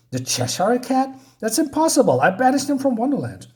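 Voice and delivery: high-pitched incredulous outburst; acted disbelief. Alice hearing of the banished cat, high incredulous voice.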